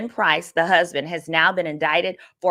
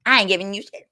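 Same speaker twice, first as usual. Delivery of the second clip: defiant refusal; 8 semitones higher, 5 dB louder than usual. Retold or imitated. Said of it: "Lynn said".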